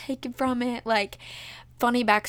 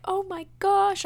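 retold happy amazement; 9 semitones higher than usual. Bride-to-be seeing parents, high delighted amazement. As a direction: replay the delighted surprise in a high voice. Retelling tearful surprise at parents.

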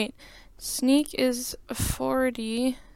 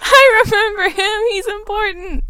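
laughing; 10 semitones higher, 8 dB louder than usual. Real, not acted. Laughing fake-earnest about JP.

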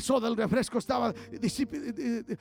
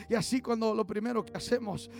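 ordinary delivery

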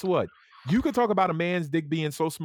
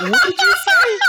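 high shrieking laugh on the words, much louder; 11 dB louder than usual. Real, not acted. Friend cracking up, shrieking the question through laughter. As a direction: squeal it out mid-laugh.

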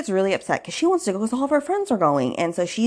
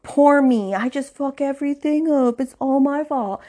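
mocking drunk self-pity; 8 semitones higher than usual. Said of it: "get drunk".

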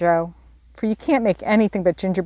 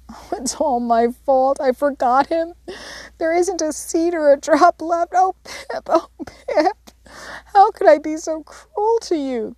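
high tearful wail over a dead pet; crying; 9 semitones higher than usual. A narrator's reading. Beth crying over her dead canary, high tearful wail. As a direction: voice lifted high and breaking with grief. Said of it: "cried Beth".

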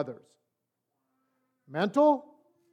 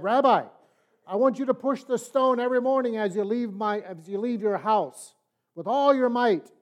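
voice of God commanding; 5 semitones higher, 5 dB louder than usual. Acted.

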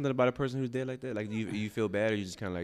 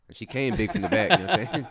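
laughing; 4 semitones higher, 6 dB louder than usual. Real, not acted. Laughing joke about J-Lo.

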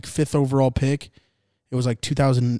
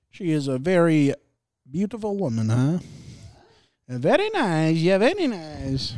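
Borat; 4 semitones higher than usual. Acted. Admiring a sideline reporter, playful mock Kazakh accent. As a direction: imitate a comic foreign accent with stretched, rising words. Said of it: "I watched this Borat thing".

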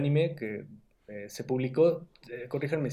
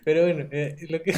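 words squeezed out through laughter; laughing; 3 semitones higher, 5 dB louder, slower than usual. Real, not acted. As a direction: laugh while saying it. Laughing at the twelve hours joke.

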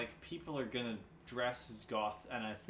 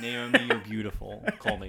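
loud, laughing pickup line; hammed-up flirty call out, laughing; laughing mock flirt; 10 dB louder than usual.

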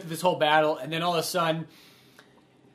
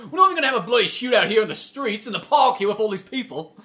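fast, higher, louder exasperated rant; mock mad; 5 semitones higher, 6 dB louder than usual. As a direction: play an indignant movie gangster getting mad. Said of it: "when he just gets mad".

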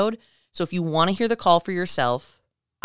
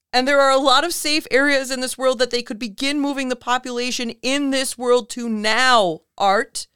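higher, louder, halting frightened warning; panicked caller; 8 semitones higher, 5 dB louder than usual. Retold or imitated. Retold caller warning Art about aliens, higher and frantic. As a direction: plead the warning with rising panic. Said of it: "on the verge of tears or panic".

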